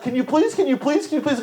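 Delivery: a petitioner nagging God; hammed-up begging; mock begging.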